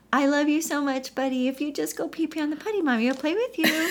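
put-on high, fast, sweet coaxing to a toddler; sugary mom voice. Mom coaxing son onto the potty, sugary high voice. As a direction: coax him with forced cheer. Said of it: "externally, I'm now going".

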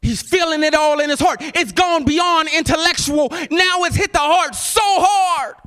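high, driving preacher's shout; impassioned sermon cry; full-force preaching. Real, not acted. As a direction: drive it home to the congregation at a high pitch.

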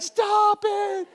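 high, loud squeal of horror; mock horror. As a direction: squeal at the kid to stop. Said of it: "I was like".

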